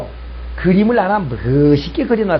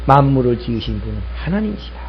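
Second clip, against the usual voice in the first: ordinary delivery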